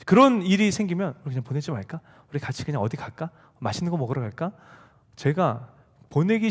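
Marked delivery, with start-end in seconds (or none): 1.2-4.5 soft coaxing. Retold or imitated. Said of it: "저희 아내가 너무 잘합니다".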